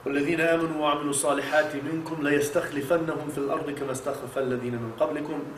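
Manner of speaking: quick, quiet recited verse in Arabic; Quran recitation. Acted. Imam reciting the verse to the congregation, quick and soft. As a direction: run the verse softly and fast, as recitation.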